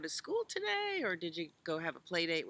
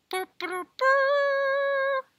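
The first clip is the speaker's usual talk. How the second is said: high loud trumpeting burrs; loud mock elephant trumpet blasts; elephant trumpet call; 11 dB louder, much slower than usual. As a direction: trumpet like an elephant raising the alarm.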